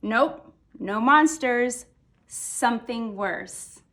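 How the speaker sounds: usual speaking voice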